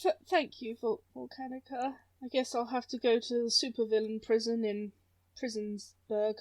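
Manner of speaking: soft, hesitant, resigned voice; sheepish thanks. Acted. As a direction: stammer it quietly, dropping away. Villain reluctantly thanks Volcanica.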